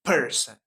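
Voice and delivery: "person" with a strong PER and a weak "son"; stress on first syllable. Shown as a demonstration. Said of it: "We must stress per".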